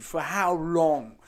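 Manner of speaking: slow, pompous toff voice, dragging each word; mock posh journalist. Mocking the journalist's fancy language, slow and pompous. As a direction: draw it out like a stuffy old gentleman. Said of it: "they use fancy language".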